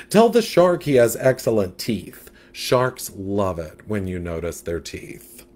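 diva fish with attitude; sassy catfish. Acted.

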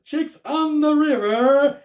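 hyped food host repeating the restaurant name; Scott Eats voice. Retold or imitated. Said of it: "That's Scott's Eats".

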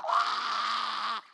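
startled outcry; shocked cry. Retold or imitated. Preacher's shocked reaction.

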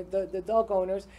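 ordinary delivery

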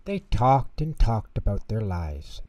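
ordinary delivery

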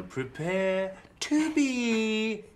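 slow, high, loud proclamation; hyped-up announcement. Pretend. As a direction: announce it like an arena host. Hyped announcement before playing music.

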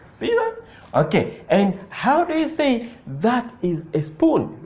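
normal talking voice

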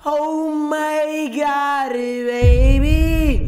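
high, long-held "oh my God"; drawn-out exclamation. Real, not acted. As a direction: stretch every word out high in excitement. Reacting to a play in game.

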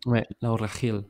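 put-on a light Spanish accent on the name; lightly put-on Spanish accent, said proudly. Co-host corrects the name, mock Spanish accent. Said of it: "Vous remarquez mon accent espagnol".

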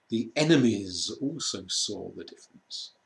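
usual speaking voice

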